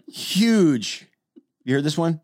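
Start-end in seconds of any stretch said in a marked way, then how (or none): none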